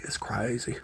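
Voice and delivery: breathy whisper to the puppies. Real, not acted. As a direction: whisper it close. Repeated softly to puppies.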